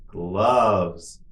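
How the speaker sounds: drawn-out word for learners; slow model. Shown as a demonstration. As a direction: say it slowly and evenly for repetition. Teacher models word for repetition.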